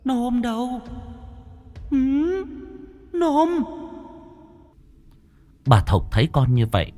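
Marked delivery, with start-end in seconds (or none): 0.0-3.6 very high, echoing ghost call; piercing ghostly voice. A narrator's reading. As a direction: call out eerily, high as tearing silk. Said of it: "Âm thanh thánh thót như xé lụa".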